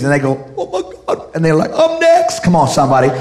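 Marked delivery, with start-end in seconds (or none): 0.6-1.4 whispered, high-pitched amazement; hammed-up breathless wonder; imitating a thrilled believer. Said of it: "real Christians". 1.7-2.4 high, excited exclamation; hammed-up believer's excitement; imitating an eager believer. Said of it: "real Christians".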